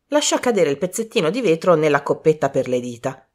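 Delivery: plain reading voice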